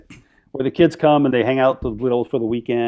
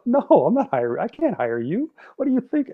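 high-pitched retold disbelief; incredulous refusal; 11 semitones higher than usual. Turning down the expelled job seeker, incredulous. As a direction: refuse as if the request were absurd, voice rising high. Retells refusing to hire him.

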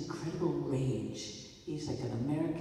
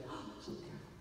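softer, voiceless whisper of overcaution; mock whisper; 10 dB quieter, faster than usual. Mock-whisper of someone too careful with a beloved book. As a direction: breathe it out as if afraid to break something. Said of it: "you're like".

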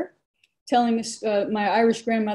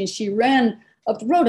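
usual speaking voice

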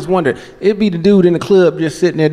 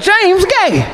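high-pitched laughing verdict; 13 semitones higher than usual. Retold or imitated. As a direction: cackle it out, high.

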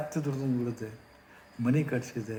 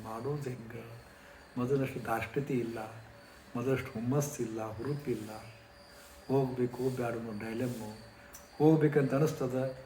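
normal talking voice

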